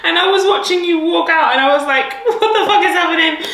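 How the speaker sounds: high, loud, through laughter; real laughing retelling. Real, not acted. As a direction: barely get it out for laughing. Cracking up recalling watching friend.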